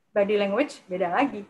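ordinary talk, nothing marked

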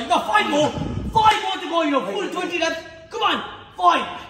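loud, pushing the last reps; shouting encouragement. Real, not acted. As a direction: yell them through the set. Coach pushing final reps.

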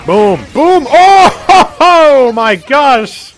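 loud, high, laughing delight; excited shouting. Real, not acted. Huge multi-kill in game.

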